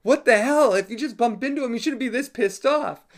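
retelling his own alarm, half laughing; panicking. Retold or imitated. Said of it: "I started to panic now".

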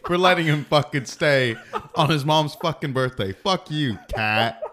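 loud, high mock-outraged yelling; mock-angry tirade. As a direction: hurl indignant abuse at an absent Kat, half laughing. Mock tirade at absent coworker.

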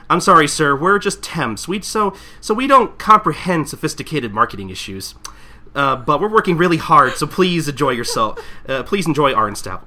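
lightly hammed-up polite staff voice; acted temp apology. Temp apologises to angry customer, lightly acted customer-service plea.

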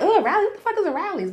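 high, snooty mock disgust. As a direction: sneer at the place like it's beneath you. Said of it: "I'm like".

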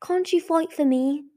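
high-pitched plea; pleading. A narrator's reading. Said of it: "Blaze pleaded".

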